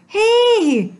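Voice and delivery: high, loud shout. Acted. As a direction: yell to stop her. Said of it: "shouted Jack".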